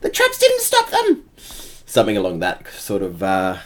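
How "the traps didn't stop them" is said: frantic high-pitched kobold alarm; draconic voice. Acted. Kobold spy warning his leader that intruders are coming, high draconic voice. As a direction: squeal the warning, loud and very high. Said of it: "in, like, some draconic voice".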